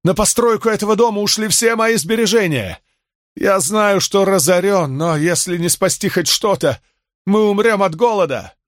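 high, pleading despair; clinging to the last of his savings. A narrator's reading. Ruined shopkeeper explains his losses to Crassus, high despairing plea. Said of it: "в отчаянии проговорил он".

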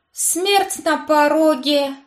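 slow, loud horrified cry; eldritch horror. A narrator's reading. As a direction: gasp it out in dread. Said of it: "потрясённо пробормотал пастор".

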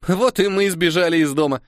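put-on high, happy little girl; joyful exclamation. A narrator's reading. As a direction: cheer with childish delight. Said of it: "радостно воскликнула Кора".

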